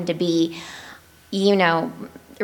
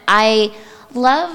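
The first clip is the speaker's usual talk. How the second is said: normal talking voice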